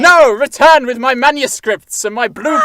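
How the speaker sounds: loud shouted command; angry shout. Acted. Husband shouts at his fleeing wife to bring back his blueprints. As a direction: call after her furiously.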